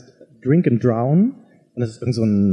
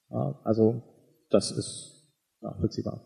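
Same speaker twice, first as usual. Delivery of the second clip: trailing off; 3 semitones lower, 9 dB quieter than usual. Real, not acted. Remark fades out at the end.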